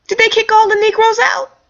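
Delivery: high indignant mock question. Real, not acted. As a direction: pretend scandalised.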